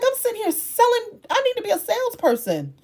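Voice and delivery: fired up and fed up; exasperated outburst. Retold or imitated. Creator retelling her frustration at TJ Maxx, exasperated.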